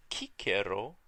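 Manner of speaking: Cicero with hard k sounds, KEE-keh-ro; loud, crisp Latin Cicero with hard c's; restored pronunciation. Shown as a demonstration. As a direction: say Cicero the ancient way, both c's as k. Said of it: "would have used the pronunciation we call the restored".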